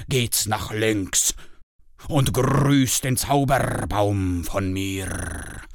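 a tamed dragon kindly giving directions; friendly dragon. A narrator's reading.

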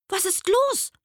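hohe Tierstimme, besorgt; Igel fragt. Acted. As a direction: eine kleine Kinderbuchfigur fragt nach. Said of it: "fragte der Igel". (hohe, neugierige Igelstimme)

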